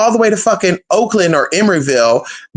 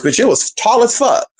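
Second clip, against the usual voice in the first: ordinary delivery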